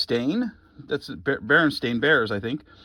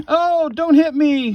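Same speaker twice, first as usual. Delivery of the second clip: high, slow, lightly pleading toy fox; mock pleading animal voice; 8 semitones higher, 5 dB louder, slower than usual. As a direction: whimper it as a scared little fox, playfully. Voicing a Beanie Baby fox.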